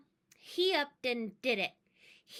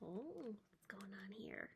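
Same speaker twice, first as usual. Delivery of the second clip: breathless aside in a whisper; hushed wondering aside; 3 semitones lower, 16 dB quieter than usual. Acted. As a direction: whisper to the audience. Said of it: "aside whisper".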